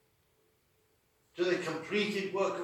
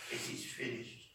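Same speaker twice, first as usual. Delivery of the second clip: softer, lower, breathy "It is finished"; whispered; 7 semitones lower, 10 dB quieter than usual. Acted. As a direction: let it sink in as a whisper. Words burning in hearts.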